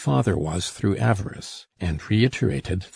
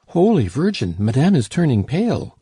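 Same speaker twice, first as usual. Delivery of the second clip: higher, louder alarmed cry; 6 semitones higher, 5 dB louder than usual. A narrator's reading. Servant crying out that her mistress is fainting, higher and louder. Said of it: "cried Nanon".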